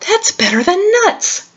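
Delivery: high, chirpy squirrel delighted with the pancakes. Acted. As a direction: squeak it out like a happy squirrel.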